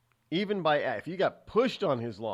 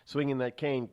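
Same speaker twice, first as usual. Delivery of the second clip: ordinary talk, nothing marked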